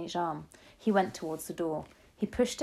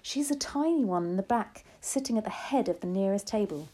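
usual speaking voice

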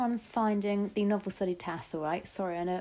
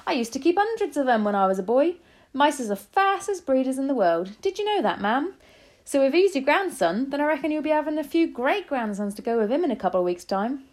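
higher, louder cabbie voice; chatty taxi driver; 6 semitones higher, 7 dB louder than usual. A narrator's reading. Taxi driver chats to grandmother about mice breeding, loudly jovial. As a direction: rattle on heartily like a cabbie.

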